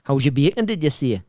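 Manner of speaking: loud Geordie catchphrase attempt; imperfect, exaggerated Geordie accent. Shown as a demonstration. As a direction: punch it out in mock Geordie. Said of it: "I'm not great at accents".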